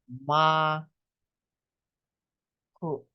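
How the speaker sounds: "ma" held long, then a separate short "k"; dropped R. Shown as a demonstration. Teacher shows the R is gone, "ma, k" in two pieces. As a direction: say "ma, k" slowly as maa, ku. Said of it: "yung R nawala na".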